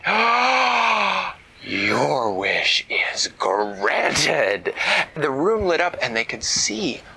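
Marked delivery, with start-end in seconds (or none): 0.1-1.3 gasping oh. A narrator's reading. Dragon granting a wish. 1.6-4.7 slow, breathy whispered magic voice; wish dragon voice. A narrator's reading. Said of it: "in her wish dragon voice".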